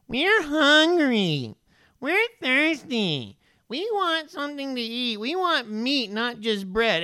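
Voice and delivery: high, slow, nasal-free whine of a grumbling people; whiny complaining crowd. Retold or imitated. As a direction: whine it like hungry ungrateful Israelites. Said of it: "And they're complaining".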